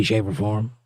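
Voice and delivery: normal talking voice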